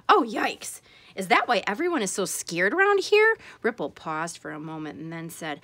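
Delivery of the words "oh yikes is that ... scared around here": high, louder little yikes; a startled, worried dolphin; mild alarm. Acted. Ripple reacts to the tale of Snark, mildly alarmed.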